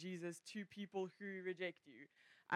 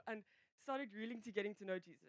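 usual speaking voice

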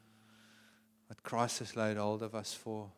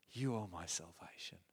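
soft answer; 5 semitones lower, 9 dB quieter than usual. Acted. The soul pleading softly for God's reassurance. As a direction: lower and softer, hushed.

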